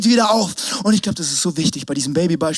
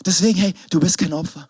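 ordinary talk

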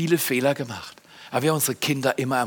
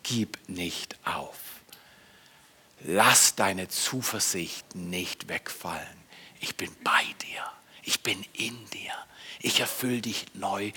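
whispering Spirit; 6 semitones lower, 10 dB quieter than usual. Acted. The Holy Spirit whispering comfort to the listener. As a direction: breathe it close, like a comforting inner voice. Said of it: "Er flüstert zu dir".

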